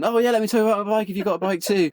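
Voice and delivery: high, quick, chatty enthusiasm; mock friendly eagerness. Biker happily answers a stranger about bikes, mock eager. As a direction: act out an eager, open reply. Acted friendly contrast to hostile reply.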